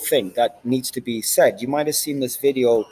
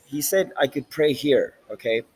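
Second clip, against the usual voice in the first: ordinary talk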